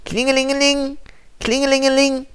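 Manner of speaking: high sing-song ringing phone; phone ring imitation. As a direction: ring it out like a telephone.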